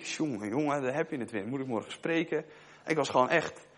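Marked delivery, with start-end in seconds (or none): 0.0-2.4 much softer, grumbling under his breath; soft irritated self-talk; muttered to himself. Retold or imitated. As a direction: sigh it inwardly, annoyed. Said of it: "dacht ik".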